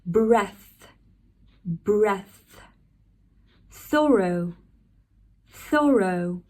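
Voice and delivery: breath with a clear th, thorough said like sorough; teacher demo, each word said twice; British TH words. Shown as a demonstration. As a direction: model words slowly, one at a time. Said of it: "how can you pronounce T H in words".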